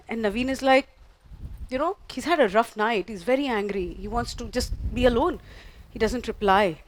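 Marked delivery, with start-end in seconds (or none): none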